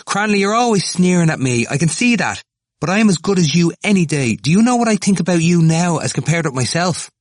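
high, loud, worked-up protest; brave defiance. A narrator's reading. Temple turning on his sneering tormentor, bravely defiant. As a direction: stand up to him at full pitch. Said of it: "Temple turned on him bravely".

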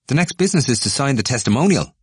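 a student leader demanding signatures; firm insistence. Acted. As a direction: press the point home. McCann presses the petition.

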